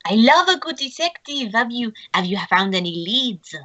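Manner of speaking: put-on French accent, opening high; French-accented eager question. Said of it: "his French accent".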